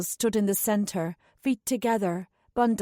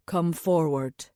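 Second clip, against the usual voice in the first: low, gentle man's voice; gentler tone; 5 semitones lower, slower than usual. A narrator's reading. Anulan gently calls the spirit child forward, low and tender. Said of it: "gave way to a gentler one".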